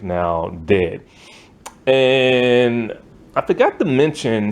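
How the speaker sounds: ordinary talk, nothing marked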